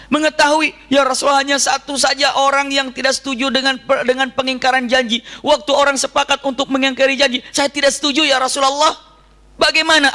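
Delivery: fervent preaching. Acted. Preacher retells the captive's plea to the Prophet, impassioned.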